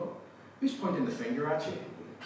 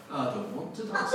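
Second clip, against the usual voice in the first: put-on small-child refusal in Yorkshire accent; 2 semitones higher than usual. Wee girl refusing to dance, in Yorkshire accent. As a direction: imitate a stubborn Yorkshire toddler. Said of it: "Sheffield is in Yorkshire".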